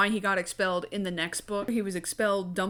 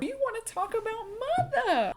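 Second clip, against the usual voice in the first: high mock voice; 14 semitones higher than usual. Mock dramatic aside.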